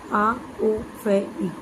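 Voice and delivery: very slow, sounds of टॉफी split: आ ओ फ ई; spelled out slowly. Shown as a demonstration. Teacher spelling टॉफी for students, very slow and separated. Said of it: "इसको हिंदी में लिखेंगे तो ऐसे".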